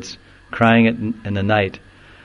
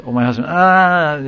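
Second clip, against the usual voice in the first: mock widow's wail, a bit higher; hammed-up wail; 4 semitones higher than usual. Widow answering her consoler, imitated with a hammed-up wail. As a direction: overact the widow's grief, trailing into a cry. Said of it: "And she said".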